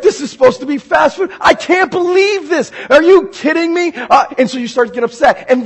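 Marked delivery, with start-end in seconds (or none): 0.0-3.9 exasperated outburst. Acted. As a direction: fume like a customer fed up with waiting. Impatient driver upset in drive-through line.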